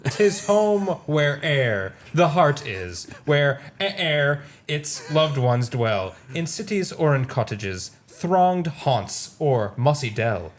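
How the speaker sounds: put-on slow British-accented poetry reading; stately British verse reading, words drawn out. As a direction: recite grandly in a British accent. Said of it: "read this in a British accent".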